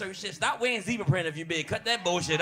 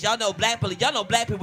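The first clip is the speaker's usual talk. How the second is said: ordinary delivery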